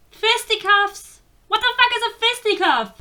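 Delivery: high, baffled questioning; loud, high-pitched bewilderment; incredulous outburst. Real, not acted. Baffled by a word she read.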